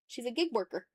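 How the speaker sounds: usual speaking voice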